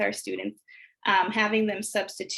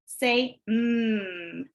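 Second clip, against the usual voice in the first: ordinary talk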